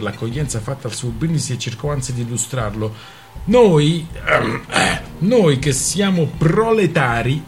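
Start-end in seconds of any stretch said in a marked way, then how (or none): 3.5-7.4 faltering speechmaker. Acted. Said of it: "attento a non strozzarti con una parola difficile".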